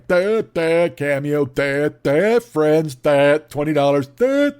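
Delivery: dopey, loud, high duh voice; duh guy impression. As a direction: play a dim-witted guy going duh. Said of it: "do eight minutes of the duh, duh guy".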